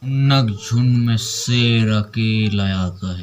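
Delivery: low filmi dialogue. Shown as a demonstration. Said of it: "एक बार और बुलवा".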